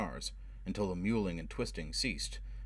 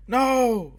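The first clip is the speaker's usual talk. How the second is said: a shrill, much higher, louder scream; terrified scream; 13 dB louder than usual. A narrator's reading. Frightened man screaming no at his interrogator. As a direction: shriek it out in panic. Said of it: "Forrest screamed".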